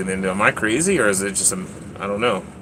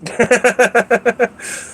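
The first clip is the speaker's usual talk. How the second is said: high giggle; 11 semitones higher than usual. Real, not acted.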